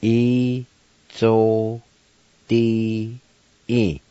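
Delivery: E Z O D E said one syllable at a time; teacher sounding out E Z O D E. Shown as a demonstration. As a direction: break E Z O D E into slow separate syllables. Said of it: "It's pronounced like this".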